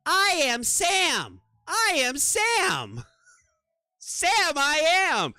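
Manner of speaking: high, very slow character voice; sing-song high cartoon character. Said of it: "one of the voices goes".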